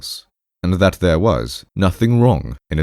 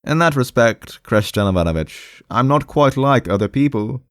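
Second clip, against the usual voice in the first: high-pitched, mildly aggrieved character voice; awkward, defensive patient; 7 semitones higher than usual. Acted. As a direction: explain yourself politely, pitched high.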